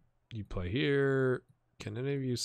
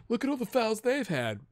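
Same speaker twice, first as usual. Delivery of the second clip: complaining manager; 11 semitones higher than usual. Acted. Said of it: "He's like".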